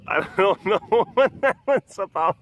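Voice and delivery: laughing. Real, not acted. Watching the pets' odd antics, laughing while talking. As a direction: laugh right through the words.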